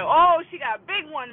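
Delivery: loud, high retold exclamation; excited holler. Grandpa hollers the news to grandma, excited holler. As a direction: announce it loudly, imitating thrilled grandpa.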